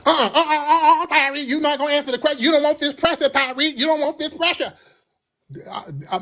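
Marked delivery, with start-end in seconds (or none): 0.0-4.7 very high, rattled-off sassy refusal; put-on indignant high voice; mock sassy. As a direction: imitate a whiny man talking big. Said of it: "Why are you so sassy".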